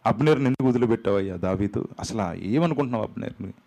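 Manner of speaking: normal talking voice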